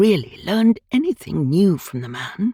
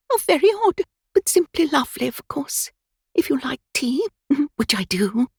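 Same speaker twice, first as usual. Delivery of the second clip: put-on much higher, fluttery old lady, shocked then giggling; a scandalised twitterer covering with a laugh; twittering old woman; 9 semitones higher than usual.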